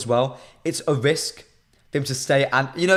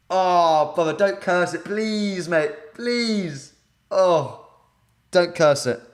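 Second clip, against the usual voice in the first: pleading; 3 semitones higher, much slower than usual. Real, not acted. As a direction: plead with him not to jinx it. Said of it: "I'm begging you, don't curse it".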